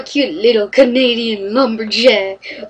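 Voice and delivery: ordinary talk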